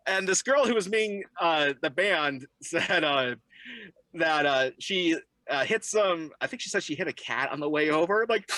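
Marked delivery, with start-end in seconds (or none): none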